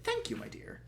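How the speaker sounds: disgusted. Acted. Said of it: "said disgustedly".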